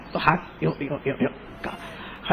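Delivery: slow whisper, almost no voice; whispered trembling. Acted. Preacher whispers while showing Abraham's shaking hand. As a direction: breathe the words as the hand shakes.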